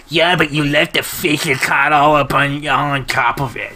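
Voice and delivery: nerdy put-on voice; [name]. Salamander objects about the freezer, nerdy voice. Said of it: "nerdiest voice ever".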